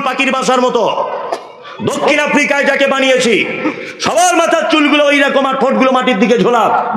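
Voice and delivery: fervent preacher declaiming; preaching at full force. Acted. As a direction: proclaim it like a voice from above. God's words, preached.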